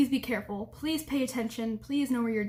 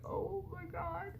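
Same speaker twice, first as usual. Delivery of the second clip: mortified; 6 semitones higher, 12 dB quieter, slower than usual. Retold or imitated. Speaker mortified at housekeeper.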